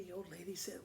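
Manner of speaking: plain reading voice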